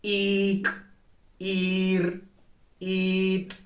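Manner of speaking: "Ik", "ir", "it" each with a long level vowel first; consonant after tone. Shown as a demonstration. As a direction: hold the "i" steadily, then close on the consonant. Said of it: "or after".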